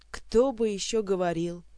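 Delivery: usual speaking voice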